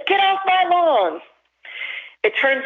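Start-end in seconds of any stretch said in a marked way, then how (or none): 0.1-1.2 crotchety mock yell, pitched way up; curmudgeon shouting. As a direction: holler it like a grumpy neighbor chasing kids away. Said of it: "curmudgeon who shouts at kids".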